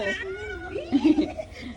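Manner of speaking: squealing very high burst of laughter. Real, not acted. Parent laughs high with delight at the baby.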